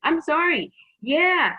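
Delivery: high, loud mock apology; emphatic dismissal. Turning down a cursing date, emphatically dismissive. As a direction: call it out as a not-sorry rejection.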